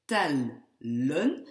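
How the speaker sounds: tellen split into tel and len; two syllables. Shown as a demonstration. Teacher showing why L doubles, tellen syllable by syllable. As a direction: leave a gap inside tellen after the L. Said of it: "pour pouvoir avoir deux syllabes".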